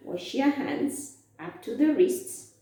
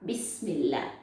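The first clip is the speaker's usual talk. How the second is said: soft low recitation; 7 semitones lower, 6 dB quieter than usual. Shown as a demonstration. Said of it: "say it, [name]".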